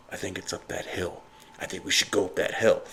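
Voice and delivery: low, quiet, overdone dramatic hush; mock hammy reality-show actor; bad TV acting. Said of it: "the acting and all is so bad".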